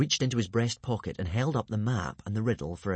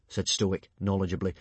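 plain reading voice

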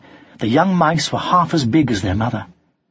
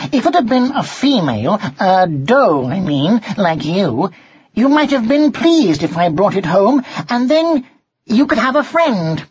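high, eager character voice; earnest young cat; 9 semitones higher, 3 dB louder than usual. A narrator's reading. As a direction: reason it out earnestly, pitched high. Cat Martin works it out.

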